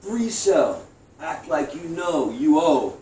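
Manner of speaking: high, loud call down the jail tier, slowing on the threats; inmate hollering. Retold or imitated. Inmate hollering down the tier at the new kid, loud. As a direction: bellow it down the cellblock like a threat. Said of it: "Somebody hollers down to my cell".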